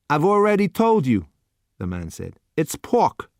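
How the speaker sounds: bored waiter pushing the last dish; gruff waiter. A narrator's reading. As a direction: waiter to customer, impatient put-on voice. Waiter impatient.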